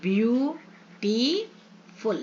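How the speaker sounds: "ब्यू-टी-फुल" very slowly with gaps; split into three syllables. Shown as a demonstration. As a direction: stretch "view" and "टी", drop on "फुल". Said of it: "तीन syllable word भी हो सकते हैं".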